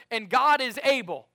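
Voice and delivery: loud, high declaration; preacher's call. Shown as a demonstration. Pastor calling the verse for the church to repeat. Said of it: "let's finish that verse".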